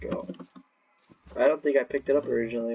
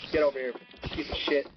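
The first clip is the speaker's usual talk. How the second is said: ordinary talk, nothing marked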